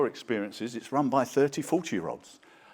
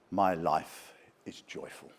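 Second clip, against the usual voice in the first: ordinary delivery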